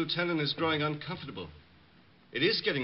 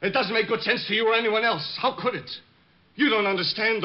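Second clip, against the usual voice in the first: louder and higher, bitter; resentful outburst; 6 semitones higher, 4 dB louder than usual. Acted. Bomber lashing out at the detective, louder and bitter. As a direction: spit it out, hurt and angry.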